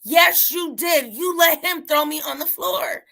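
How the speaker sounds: imitating a furious boy, loud and slow; upset retold shout. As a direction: shout it like the son accusing the teacher. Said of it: "he's getting upset".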